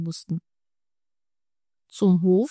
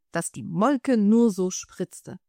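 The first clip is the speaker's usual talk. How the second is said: ordinary delivery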